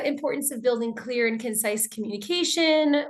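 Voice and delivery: ordinary talk, nothing marked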